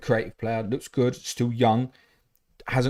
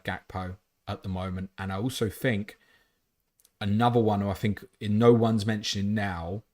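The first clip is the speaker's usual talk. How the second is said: ordinary talk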